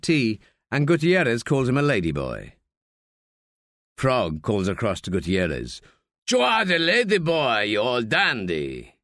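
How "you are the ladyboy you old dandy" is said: mocking old man. Frog mocking Gutierrez across bar.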